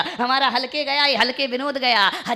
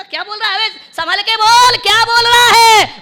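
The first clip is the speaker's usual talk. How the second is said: high, loud warning, building; acted scolding, last question stretched out; angry challenge; 11 semitones higher, 14 dB louder than usual. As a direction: shout him down as the offended sister-in-law. Sister-in-law angrily challenges the teaser.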